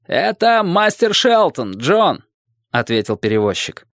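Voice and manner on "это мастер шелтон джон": high, slow answering call; hailing someone across water; calling back. A narrator's reading. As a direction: draw it out high, then drop on the name. Ferryman calls back across water.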